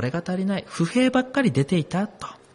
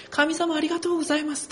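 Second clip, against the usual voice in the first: high, quick joyful thanks to God; 11 semitones higher, 4 dB quieter, faster than usual. Retold or imitated. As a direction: sing out gratitude lightly. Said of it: "喜びの賛美を捧げながら". (喜びに満ちた軽やかな感謝)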